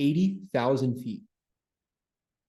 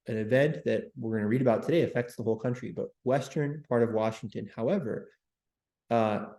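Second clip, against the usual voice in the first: ordinary delivery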